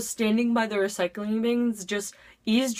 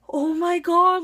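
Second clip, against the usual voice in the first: a loud, higher, shocked gasp of words; shocked exclamation; 9 semitones higher, 6 dB louder, slower than usual. Retold or imitated. Caught in the wrong classroom, loud shocked cry. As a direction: blurt it out in shock. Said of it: "And then I'm just like".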